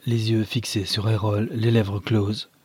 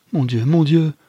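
high, loud anguished lament; acted despairing cry; distraught exclamation; 6 semitones higher, 6 dB louder than usual. A narrator's reading. Distraught husband wringing his hands.

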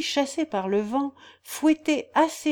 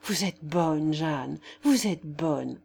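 low, slow, moved almost to tears; a young baron, choked with sudden tenderness; voice breaking; 5 semitones lower, slower than usual. A narrator's reading. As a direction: let the feeling catch in the throat, slow and low. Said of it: "il répondit d'une voix altérée".

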